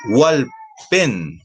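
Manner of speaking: Walpen in two clear beats, weight on pen; teacher's demonstration of Walpen stressed at the end; stress on final syllable. Shown as a demonstration. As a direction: say Walpen carefully, leaning on its last syllable. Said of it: "También la fuerza de voz termina en".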